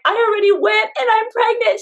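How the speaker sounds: high, overwhelmed retelling; squeaky, mock-tearful announcement; retold tearful outburst. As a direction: imitate blurting news through tears. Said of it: "And I start crying".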